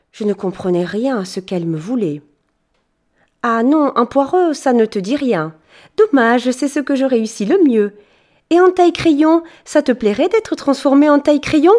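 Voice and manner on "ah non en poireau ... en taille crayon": higher, louder bubbly apprentice fairy; sweet and chatty, eager to help; fairy thanking the boy, bubbly; cheerful fairy. Acted. Fairy keeps offering transformations.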